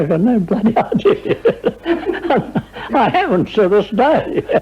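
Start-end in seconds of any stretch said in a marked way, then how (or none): 0.0-1.4 laughing through his own answer; high, laughing reply. Retold or imitated. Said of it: "I said". 1.4-2.9 a high breathy laugh at himself. Real, not acted. Laughing at his own story.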